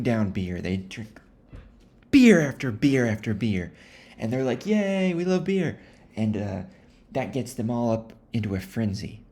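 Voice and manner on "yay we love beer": high, slow goofy cheering; mock drunk cheer. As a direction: cheer like tipsy sailors.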